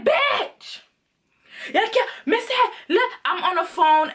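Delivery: high-pitched, agitated mock yell; retold phone screaming. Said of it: "I'm screaming my head off".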